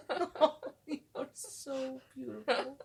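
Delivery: high, slow, laughing and teary; overwhelmed, half laugh half cry; laughing through tears. Real, not acted. Moved by the poem her partner read, laughing and teary. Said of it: "you're gonna make me cry".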